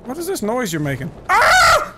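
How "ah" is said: short, very high loud scream; high-pitched "Ah!" of shock; startled scream. Real, not acted. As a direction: yelp out in sudden fright. Startled by enemy in game.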